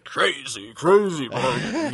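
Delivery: slow, booming announcer hype; monster truck ad. Pretend. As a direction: stretch it like a monster truck promo. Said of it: "We need that monster truck voice to come in".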